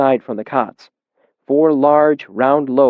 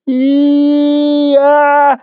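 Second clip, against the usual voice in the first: high, loud drawn-out cry; wordless cry; 7 dB louder than usual. A narrator's reading. Tied boy calling to the man, high loud cry. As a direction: strain and cry out long. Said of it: "he said, and tugged".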